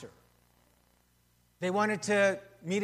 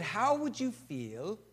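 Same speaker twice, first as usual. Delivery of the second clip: slow, drawn-out British English imitation of church leaders; British accent; 2 semitones higher, much slower than usual. Pastor quoting English church leaders, in a slow British accent. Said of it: "with their British accent".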